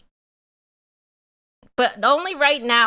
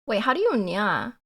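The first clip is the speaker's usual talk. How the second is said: normal talking voice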